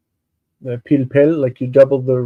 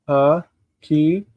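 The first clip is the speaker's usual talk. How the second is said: syllable by syllable. Shown as a demonstration. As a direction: pause after each syllable of hakimoti. Teacher sounds out word in syllables.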